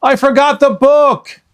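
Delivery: put-on high, loud boyish dismay; a boy groaning over a forgotten book. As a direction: realize the mistake out loud. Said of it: "he said".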